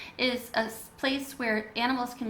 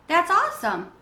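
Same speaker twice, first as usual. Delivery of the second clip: high, louder happy exclaim; excited; 7 semitones higher, 7 dB louder than usual. A narrator's reading. Bat reacting to Mom's news, high and excited. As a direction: cheer at the good news.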